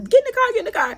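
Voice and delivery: high, hurried mimic; retold arguing. Sister arguing to get her in the car, high-pitched mimicked urging. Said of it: "like, arguing".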